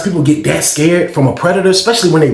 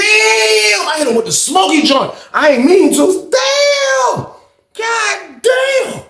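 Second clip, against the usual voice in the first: shocked yell at hitting him; shocked exclamation; much slower than usual. Retold or imitated. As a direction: blurt it out in shock, high. Retelling his own shocked reaction.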